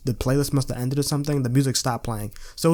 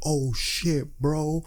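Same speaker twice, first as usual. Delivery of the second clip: mouthed; 5 dB quieter, much slower than usual. Retold or imitated. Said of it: "he's, like, mouthing".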